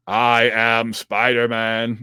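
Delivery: slow, stiff monotone robot Spider-Man. Acted. Imitating the robot Spider-Man introducing itself, robot voice. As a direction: speak like a robot announcing itself. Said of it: "talks like a robot".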